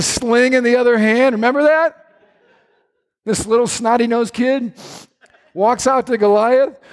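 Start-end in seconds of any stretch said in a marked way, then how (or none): none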